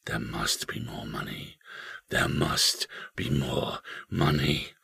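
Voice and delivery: eerie low whisper, slowing down; hushed, drawn-out ghostly whisper. A narrator's reading. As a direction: whisper it slowly, like a haunted house. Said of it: "It came whispering from the springs".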